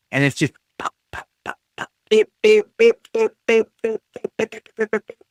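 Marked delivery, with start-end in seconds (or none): 2.1-5.2 higher, rapid beeps like pinging alerts; imitated notification beeps. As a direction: mimic the notifications going off nonstop. Imitating Discord notification sounds.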